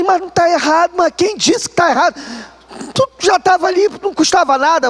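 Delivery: high scolding mother voice; mock parent scolding. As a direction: chide him, it cost nothing.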